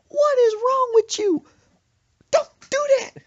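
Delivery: very loud, high screamed scolding; furious mother. Retold or imitated. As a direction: yell it right after a bad scare. Startled mother scolds prankster son.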